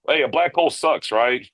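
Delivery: usual speaking voice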